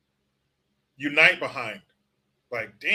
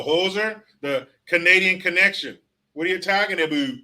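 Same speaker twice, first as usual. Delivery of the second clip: ordinary talk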